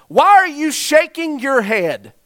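loud, high, slow demand at a heckler; exasperated demand. Retold or imitated. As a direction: call him out from the stage, drawn out. Frustrated speaker confronts heckler.

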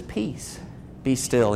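very soft, measured command; hushed command. Acted. Jesus to the wind and sea, hushed command. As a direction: say it softly, with a pause between phrases. Jesus voiced calming the storm.

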